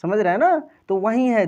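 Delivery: ordinary talk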